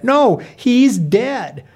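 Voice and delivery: "No, he's dead" slow and high; emphatic protest. Acted. Protests dead character returning.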